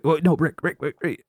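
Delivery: half-hearted imitation of frantic interrupting; mock urgent protest. George trying to stop boss drinking, mock urgent.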